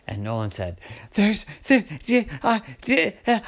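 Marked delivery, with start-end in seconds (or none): 1.1-3.4 high panicked gasps between broken words; too scared to get words out; huffing and puffing. Acted. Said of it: "huffing and puffing and breathing funny".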